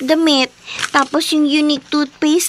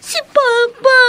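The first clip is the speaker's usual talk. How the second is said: sobbing out the word, voice raised; crying; 9 semitones higher, 3 dB louder than usual. Acted. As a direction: choke it out in tears.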